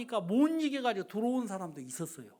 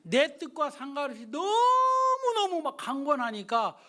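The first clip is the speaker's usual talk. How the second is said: louder, slower impassioned preaching; fervent preaching; 3 semitones higher, 5 dB louder than usual. Acted. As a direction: press it home with fervour. Preacher building emphasis. (힘주어 느리게 외치는 설교)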